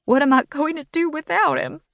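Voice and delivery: high, tearful wail; crying. A narrator's reading. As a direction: cry it out into the dog's fur. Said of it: "I cried".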